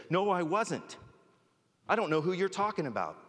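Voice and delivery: high, hammed-up defensive denial. Peter denying Jesus to the girl by the fire, defensive. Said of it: "Getting all defensive".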